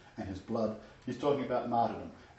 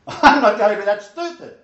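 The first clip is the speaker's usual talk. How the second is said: much louder, high-pitched exclamation; comic outburst; 9 semitones higher, 14 dB louder than usual. Real, not acted. Preacher repeats his refusal to judge others, in a comic shout. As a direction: shout it in exaggerated protest, laughing first.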